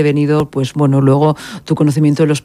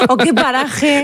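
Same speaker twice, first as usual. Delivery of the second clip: lightly voiced curious friend, higher; friend asking; 9 semitones higher, slower than usual. Host mimicking a friend asking the guest for advice, higher voice. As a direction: play a friend asking for tips, pitch raised.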